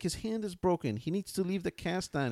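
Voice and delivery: high-pitched imitation of a worked-up child; retold childish protest, high; worked-up little kid. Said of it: "like, really worked up".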